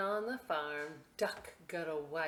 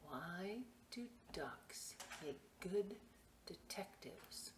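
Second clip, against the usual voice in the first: hushed riddle; 13 dB quieter than usual. Acted. Telling a joke in a hush.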